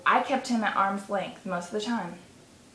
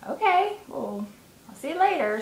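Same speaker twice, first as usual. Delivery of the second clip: quoting herself; 7 semitones higher than usual. Retold or imitated. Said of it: "And I said".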